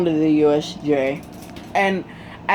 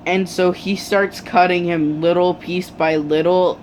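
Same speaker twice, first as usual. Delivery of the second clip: usual speaking voice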